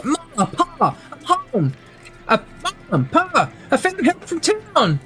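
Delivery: put-on very high boy's voice calling to his parents; excited boy calling out. Boy running towards the door with news for Pa, high excited calling. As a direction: call out high, like a kid running home.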